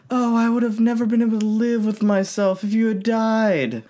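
high mocking damsel voice; lame response. Mocking Buttercup's lame reply to the rescue, high and whiny. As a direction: whine it out like a swooning princess. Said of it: "this lame response like".

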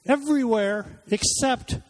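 rising, emphatic preaching voice that slows at the end; sermon delivery at full force; preaching build. Acted. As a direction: build the list up to a pointed stop. Preacher builds toward a climax.

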